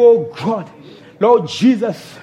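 usual speaking voice

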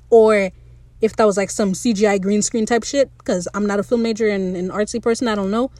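ordinary talk, nothing marked

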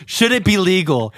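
loud, high, slow emphatic delivery; mock outrage. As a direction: hammer out each word in amused disbelief. Mock emphatic restating of question.

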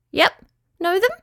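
high squeaky little creature; tiny high-pitched squeak; Petruchio. A narrator's reading. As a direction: squeak it as a small yabby. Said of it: "shrugged Petruchio".

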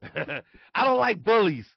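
slow, high and loud Tyson voice; Mike Tyson impression. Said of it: "Mike Tyson does not like bullies".

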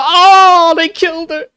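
hammed-up innocent bystander, hand to chest; playing it off. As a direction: fake shock to cover a crime, overacted. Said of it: "you know, play it off".

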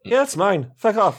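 high-pitched, defensive Irish character; Irish accent. Acted. Triss warns others off the weapon, in a light Irish accent. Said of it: "Triss has always been Irish".

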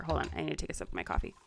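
soft, fast, close to the mic; hushed, quick aside; quiet aside. Real, not acted. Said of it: "a little A S M R-y".

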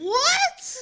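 high laughing squeal of surprise; surprised laughing what. Real, not acted. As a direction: blurt it out laughing, shocked. Shocked reply to bitch buff.

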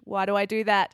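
usual speaking voice

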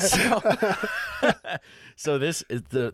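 high laughing start. Real, not acted. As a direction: laugh while starting to explain. Explaining through laughter.

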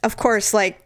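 ordinary delivery

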